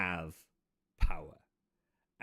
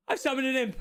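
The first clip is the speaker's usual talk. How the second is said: high, loud excited cry; thrilled; 14 semitones higher, 4 dB louder, faster than usual. Acted. Apprentice wizard thrilled at summoning an imp. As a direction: announce it like you can't believe it worked.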